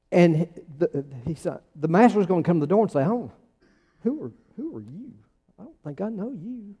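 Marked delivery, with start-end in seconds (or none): 3.0-6.7 soft, high-pitched doubtful master; puzzled non-recognition. Acted. Master to the stranger at his door, soft puzzled disowning. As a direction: quietly feign not knowing the caller.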